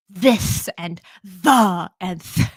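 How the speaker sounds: "This and the and th-" with long, spitting TH; over-enunciated TH. Retold or imitated. German relatives trying English, "This and the and th-" with exaggerated TH. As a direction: spit out every TH in "This and the and th-". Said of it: "really over enunciate, uh, and exaggerate".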